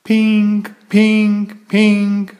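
high, loud electronic pink pink pink; shrill beeping alarm call; alarm ringing. Acted. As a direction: ring it out like an alarm clock. Alarm clock ringing sound.

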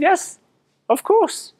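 high-pitched, eager acceptance; eager yes. Retold or imitated. Replying to the Reuters request, high and eager. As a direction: agree at once in a keen, high voice. Acts out his eager agreement.

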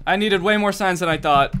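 normal talking voice

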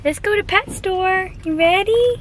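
high sing-song pet voice; talking to a puppy. Real, not acted. Baby talk to the new puppy.

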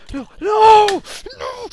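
very loud, high shriek; real terrified scream. Real, not acted. Scream at sudden death.

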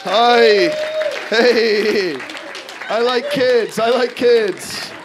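high, drawn-out awkward hello; overdone innocent greeting. Acted. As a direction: try far too hard to seem normal. Awkwardly acting normal to waiting staff.

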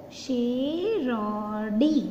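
very slow, stretched out for the class; slow teacher's demonstration; slow demo word. Shown as a demonstration. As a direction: draw each syllable out for students. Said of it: "ऐसे ही हम".

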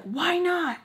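high, loud mock-indignant comeback; defiant retort. Mom answering daughter's why, defiant. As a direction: throw it back as an affronted mom. Mom snaps back in argument.

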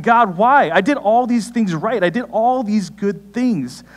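lightly acted aggrieved plea; mock complaint to God.

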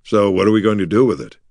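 ordinary delivery, nothing marked

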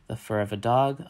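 usual speaking voice

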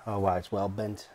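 ordinary talk, nothing marked

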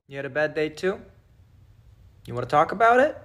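soft, low coaxing; tender to toys. Acted. Talking gently to her stuffed animals, soft and tender. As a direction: comfort a sad stuffed animal.

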